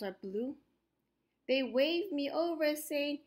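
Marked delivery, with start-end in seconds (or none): none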